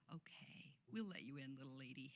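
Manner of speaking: soft, patronizing pat-on-the-head tone; condescending mock. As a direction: talk down to her quietly. Mocking condescending gatekeepers.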